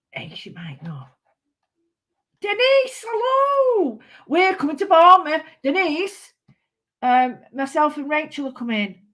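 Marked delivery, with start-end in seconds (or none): 0.1-1.0 hushed, conspiratorial whisper. Real, not acted. Crafter confiding to viewers, real whisper. Aside about a friend. 2.4-6.3 high, louder sing-song hello; excited greeting. Real, not acted. Crafter greeting Denise through the camera, excited high call. Calling out to a friend.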